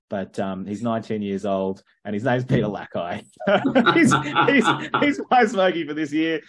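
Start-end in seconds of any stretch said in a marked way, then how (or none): 3.5-6.4 laughing. Real, not acted. Laughing at own pick.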